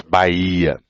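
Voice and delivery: 'Bahia' with a long stressed 'i', ba-HI-a; 'Bahia' stretched on its second-last syllable; penultimate stress. Shown as a demonstration. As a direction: lean slowly on the middle of 'Bahia'. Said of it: "penúltima sílaba".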